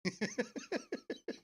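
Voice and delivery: soft falsetto giggle; a thin, high giggle; high squeaky laugh. Real, not acted. Teasing about old age.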